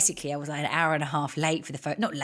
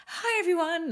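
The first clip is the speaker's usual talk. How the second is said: sing-song greeting; 14 semitones higher than usual. Retold or imitated. Walking into the shoot two hours late, sing-song greeting. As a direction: sing out a high hello to the room. Arriving late with Diet Coke crate.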